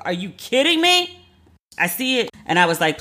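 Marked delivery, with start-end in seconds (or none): 0.0-1.2 high, slow, stretched "Are you kidding me"; incredulous. Retold or imitated. Reading the audacious promo email, high and drawn-out in disbelief. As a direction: drag it out in pure disbelief.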